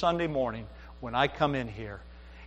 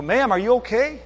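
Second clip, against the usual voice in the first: raised, louder voice of concern; acted anxious check, pitched up; concerned question; 7 semitones higher, 5 dB louder than usual. Pastor checking on a distressed stranger, concerned.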